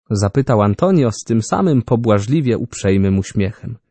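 plain reading voice

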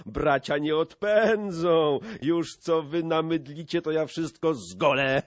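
high, loud boast; rowdy, excited pledge; eager brawler joining in. A narrator's reading. Another brawler eagerly joins in.

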